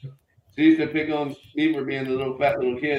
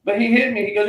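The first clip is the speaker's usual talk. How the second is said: ordinary talk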